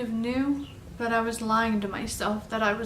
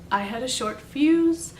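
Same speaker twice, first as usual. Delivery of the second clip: ordinary talk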